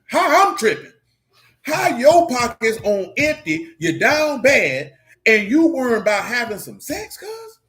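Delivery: high, loud and drawn-out disbelief; incredulous rant. Retold or imitated. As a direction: throw it back at them, outraged, stretching the words. Mock quarrel with his friends.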